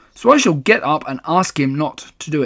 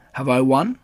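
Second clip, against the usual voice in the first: ordinary delivery, nothing marked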